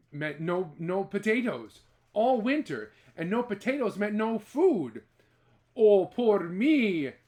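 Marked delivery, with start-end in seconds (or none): 5.8-7.1 slow, loud, high self-pitying wail. Acted. Jamie wailing over his fate, slow and loud. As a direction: wail it out, feeling sorry for yourself. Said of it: "wailed Jamie".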